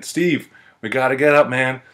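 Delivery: acted-out weary appeal; exasperated pleading. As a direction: coax a drunk friend to his feet. Pleading with drunk friend, retold.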